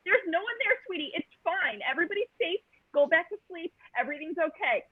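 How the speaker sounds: calm him down gently; tender hush to a half-asleep partner; soothing. Retold or imitated.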